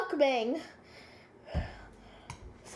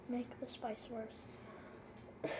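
whisper; 5 semitones lower, 15 dB quieter, much slower than usual. Real, not acted. Mouth burning, strained.

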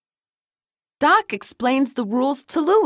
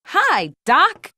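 lightly yelled, higher-pitched greeting; mild yell; 5 semitones higher than usual. A narrator's reading. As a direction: call it out with a touch of a yell. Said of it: "it yells loudly".

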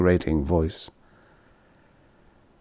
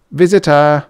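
chirpy mechanical call, raised and loud; singsong door announcer; 6 semitones higher, 6 dB louder than usual. A narrator's reading. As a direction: sing out like a doorbell robot. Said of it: "the automatic door announcer sang out".

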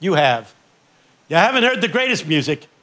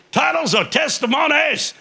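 high ringing titles or testimonies; 9 semitones higher than usual. Retold or imitated. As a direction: land it like a preacher's refrain.

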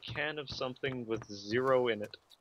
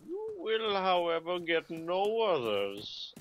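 high, slow mock-solemn decree; grand pronouncement; 6 semitones higher, 4 dB louder, slower than usual. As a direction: lay down the law like a villain.

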